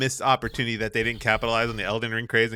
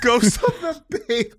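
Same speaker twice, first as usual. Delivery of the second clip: laughing; 10 semitones higher, slower than usual. Real, not acted. Cracking up at the title.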